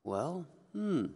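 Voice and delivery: ordinary delivery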